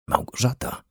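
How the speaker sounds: breathy, unvoiced whisper of a name. A narrator's reading. Man sees the girl standing in the kitchen, whispering. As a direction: whisper her name softly, barely voiced. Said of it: "szepnął".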